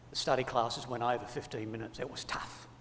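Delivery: breathy whisper, a pitiful excuse; whispered mock excuse. Mock excuse at judgment seat.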